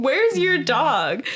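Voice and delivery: a drawn-out where's your dog; incredulous question. Retold or imitated. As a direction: ask it stretched in disbelief. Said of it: "And I was like".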